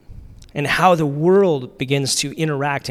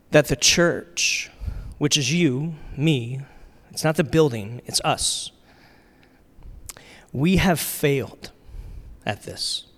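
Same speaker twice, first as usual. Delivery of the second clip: grave confession with long pauses, sinking softer at the end; deliberately dramatic; 3 dB quieter than usual. Real, not acted. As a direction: let each phrase hang, then drop away. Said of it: "I'm gonna get more dramatic".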